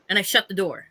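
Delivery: ordinary talk, nothing marked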